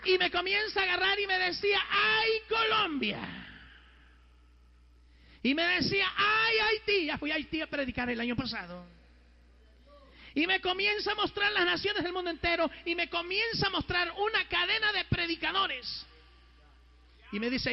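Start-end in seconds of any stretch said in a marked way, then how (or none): 0.0-7.1 high, ringing proclamation to a crowd; full-force preaching. Acted. Preaches, crying out the nations. 10.3-16.1 fervent preacher's testimony, pitched high; impassioned preaching. Real, not acted.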